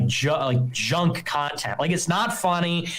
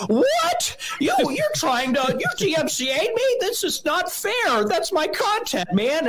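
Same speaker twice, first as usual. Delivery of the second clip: mock crybaby squealing over a takedown; mocking whiny outrage; 12 semitones higher than usual. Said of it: "they go".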